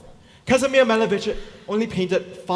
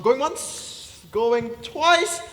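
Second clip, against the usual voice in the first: fish market auctioneer; 5 semitones higher, 5 dB louder, much slower than usual. Acted. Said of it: "auctioneer closes sale".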